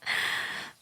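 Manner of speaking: breathy, voiceless little laugh; quiet huff of amusement; soft chuckle. Acted. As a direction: chuckle under your breath.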